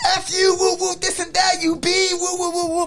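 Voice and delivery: high, loud cussing; cursing shout. Retold or imitated. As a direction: bark curses back, loud. Attackers cursing back, bleeped.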